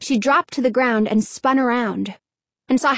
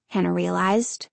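usual speaking voice

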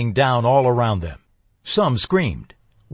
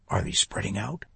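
hushed; 3 semitones lower, 8 dB quieter than usual. A narrator's reading. Whispering in the hayloft ambush.

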